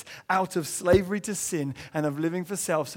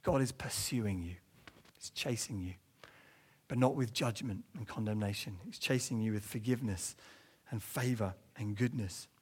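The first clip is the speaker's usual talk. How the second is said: lower, soft, reassuring; tender appeal; 7 semitones lower, 7 dB quieter than usual. Acted. Preacher tells listeners softly that God chases them with forgiveness.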